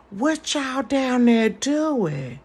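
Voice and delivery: slow, high, drawn-out creepy call. Retold or imitated. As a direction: lure the kids with a slow creepy drawl. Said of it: "I'm like this with the kids".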